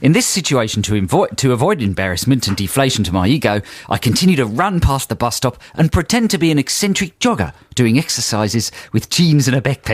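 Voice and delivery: put-on Aussie accent for a letter; comic Australian letter-reading. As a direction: read it out as a mock Australian. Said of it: "carry on in the correct accent".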